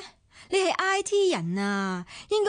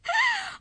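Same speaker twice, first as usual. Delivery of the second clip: scornful laugh. Acted. Scoffing at claim of jealousy.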